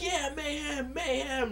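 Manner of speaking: high, light chanting yell; mock crowd yell. Fan joining the crowd chant, mild mock yell. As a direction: join the crowd half-shouting. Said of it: "we're yelling out mayhem".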